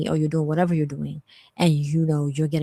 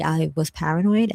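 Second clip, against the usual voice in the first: normal talking voice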